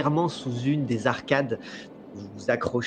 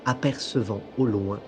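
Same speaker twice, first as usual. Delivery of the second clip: calm, measured storytelling voice; game master narration. A narrator's reading. Game master sets the scene.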